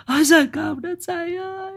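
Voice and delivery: very high, piercing shriek of grief; wailing cry. A narrator's reading. Daughter-in-law shrieks to heaven over the body, piercing wail. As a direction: throw the cry up to the sky. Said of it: "khóc giống lên".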